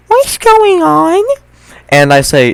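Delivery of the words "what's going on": put-on high, small child's voice; toddler voice. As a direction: voice the little kid, high and wide-eyed. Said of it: "And he says to me".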